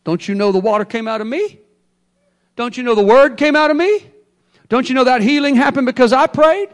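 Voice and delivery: a puffed-up preacher demanding credit; boastful rant. Retold or imitated. Preacher mocking a proud minister, building boastful demand.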